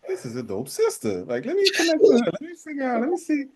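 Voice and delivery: high, excited inner voice. Retold or imitated. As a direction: gush about a new connection. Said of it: "I was like".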